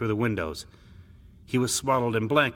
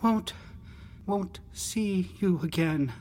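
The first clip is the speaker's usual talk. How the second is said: high, halting adolescent voice; slow, high plea through quivering lips; quivering-lipped boy; 11 semitones higher, slower than usual. A narrator's reading. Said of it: "His lips quivered".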